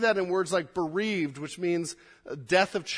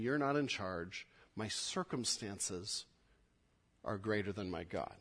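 hushed, softer challenge; quiet; 8 semitones lower, 10 dB quieter than usual. Acted. Pastor voices the doubting believer, hushed. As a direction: drop the voice low and hushed. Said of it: "and saying".

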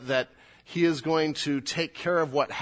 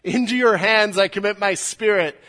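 a dying cry of victory, full voice; loud and victorious; 8 semitones higher, 11 dB louder than usual. Retold or imitated. Jesus crying out to his Father, loud and victorious. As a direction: ring it out loud and triumphant. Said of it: "he says it loudly, I think victoriously".

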